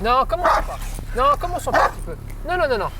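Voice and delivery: firm scolding no. Real, not acted. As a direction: tell the dog off firmly. Trainer scolds biting dog.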